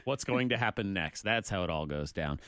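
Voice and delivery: ordinary talk, nothing marked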